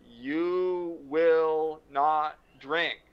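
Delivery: very slow, stern commanding proclamation; mock stern government decree. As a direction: lay down the law like a government. America's prohibition command.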